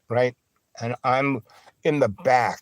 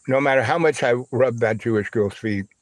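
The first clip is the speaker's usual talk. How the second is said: plain reading voice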